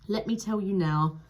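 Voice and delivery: ordinary talk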